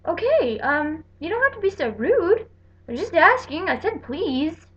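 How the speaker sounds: mock-indignant reply to the rude lady; offended retort. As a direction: act hurt and put out.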